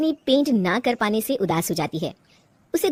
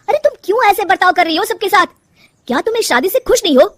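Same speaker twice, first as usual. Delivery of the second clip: loud, high, exasperated questioning; agitated husband pressing his wife; upset confrontation; 9 semitones higher, 7 dB louder, faster than usual. Acted. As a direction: demand to know what is wrong. Husband confronts wife about behaviour.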